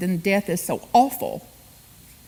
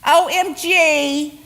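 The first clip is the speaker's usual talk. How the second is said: slow high drawn-out O M G; drawn-out mock alarm; mock panic; 6 semitones higher, 5 dB louder, much slower than usual. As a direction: ham up a shocked gasp. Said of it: "So instead of".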